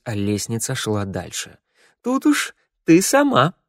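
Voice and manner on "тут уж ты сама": very high, slow lady's voice; a high grand lady, drawn out; queen of hearts voice. Acted. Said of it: "сказала дама".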